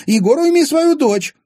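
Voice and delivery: very high, loud indignant squeal; outraged demand. A narrator's reading. Wife demanding Egor restrain his daughter, outraged. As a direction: demand it at a shrill, loud pitch.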